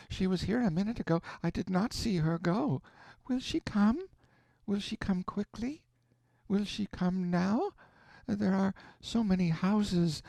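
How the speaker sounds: put-on high, frantic delirious child; delirious young girl calling for her mother; feverish girl babbling. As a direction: plead lost in fever, voice up high. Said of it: "The girl babbled on".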